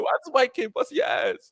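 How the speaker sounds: high-pitched, laughing retold scolding; playfully berate, voice pitched right up; mock scolding parent. Parent to son about the game, high mock scolding.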